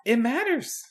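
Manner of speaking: "It matters" pitched high at normal volume; high-pitched pronouncement. Pretend. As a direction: say "It matters" high and drawn toward mystery. First of two teasing repeats.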